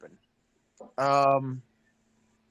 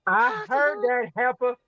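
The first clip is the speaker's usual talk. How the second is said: high, offended clapback; sassy retort; 9 semitones higher, 3 dB louder, faster than usual. Acted. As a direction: snap back, insulted.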